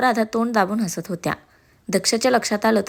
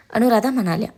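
ordinary delivery, nothing marked

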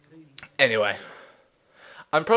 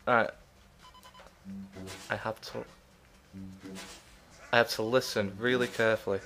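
soft, low, halting murmur; hushed concentration; 5 semitones lower, 10 dB quieter, faster than usual. Real, not acted. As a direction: speak sparingly and softly, as if listening hard. Listening hard for faint beeps.

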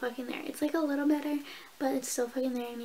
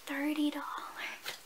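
whispered price; 7 dB quieter, slower than usual. Real, not acted. Confiding price of hoodie.

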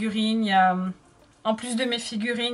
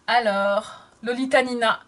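ordinary talk

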